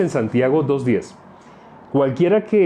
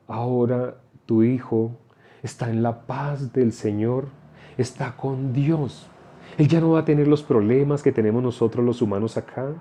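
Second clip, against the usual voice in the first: soothing consolation. Acted. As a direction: lull a grieving mother gently and slowly. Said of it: "le habla de una manera hermosa".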